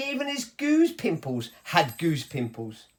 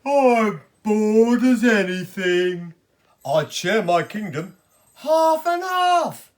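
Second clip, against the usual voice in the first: slow, loud, sulky royal grumble; bored grumpy king voice; 4 semitones higher, 6 dB louder, slower than usual. Acted. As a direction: moan it like a bored spoilt king. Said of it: "a sad and grumpy king who said".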